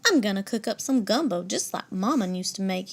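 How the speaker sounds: plain reading voice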